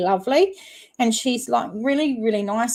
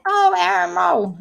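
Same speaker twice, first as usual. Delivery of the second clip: high, loud "oh" of discovery; sudden realisation; 10 semitones higher, 5 dB louder than usual. Real, not acted. Streamer finally finding the channel searched for, sudden realisation. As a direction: exclaim it as the penny drops.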